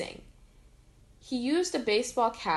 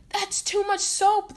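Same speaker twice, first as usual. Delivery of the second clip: high, loud, slowed cry of alarm; 10 semitones higher, 6 dB louder, slower than usual. A narrator's reading. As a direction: wail it as the suds pile up. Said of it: "the doctor cried".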